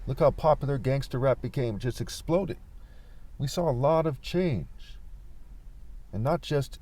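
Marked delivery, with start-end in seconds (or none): none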